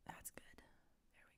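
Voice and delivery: whisper. Real, not acted. Whispers while working.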